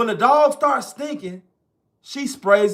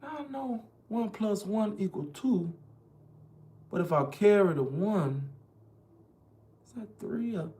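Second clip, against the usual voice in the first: mock confused muttering; 13 dB quieter than usual. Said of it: "I'm thinking".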